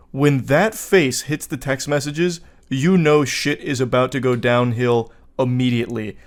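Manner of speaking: ordinary talk, nothing marked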